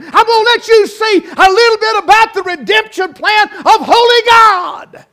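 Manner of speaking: shouted, high and loud proclamation of God; booming voice of God. Acted. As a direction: thunder it out as God revealing the plan. Preacher voicing God at climax.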